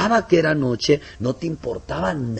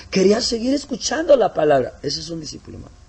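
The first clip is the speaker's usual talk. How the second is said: normal talking voice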